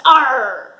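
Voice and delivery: loud pirate growl; pirate arr. Acted. Reading the pirate part to children, loud pirate cry. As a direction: bellow it like a pirate chief.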